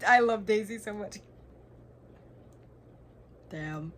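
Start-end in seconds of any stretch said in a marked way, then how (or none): 0.1-1.2 excited. Real, not acted. Reacting to a plot twist.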